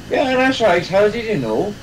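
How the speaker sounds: a hint of a thick Northern Irish accent. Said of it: "the Derry accent is very thick".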